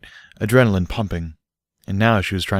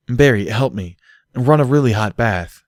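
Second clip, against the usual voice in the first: ordering; 4 semitones higher, 4 dB louder than usual. A narrator's reading. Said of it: "Derpy ordered".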